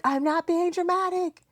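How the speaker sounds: put-on high, whiny kid protest; mother mocks her daughter's high, whining protest; imitating whiny daughter. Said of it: "And you're like".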